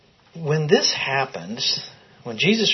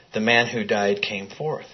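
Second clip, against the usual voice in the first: ordinary delivery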